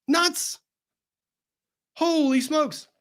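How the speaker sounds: high, incredulous exclamations; exasperated disbelief. Acted. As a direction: throw up your hands in disbelief.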